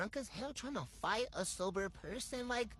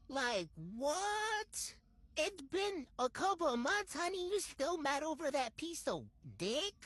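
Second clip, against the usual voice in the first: high-pitched, exasperated disbelief; incredulous; 7 semitones higher than usual. Real, not acted. Rival calls months later, incredulous. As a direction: pitch it way up, baffled at the nerve.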